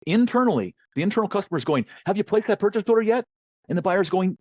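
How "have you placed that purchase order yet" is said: higher, pressing voice demanding an update; impatient colleague. Retold or imitated. Internal customer nags the buyer about the order, impatient and higher.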